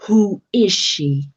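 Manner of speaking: loud, slow demand with a touch of jealousy; jealous demand. A narrator's reading.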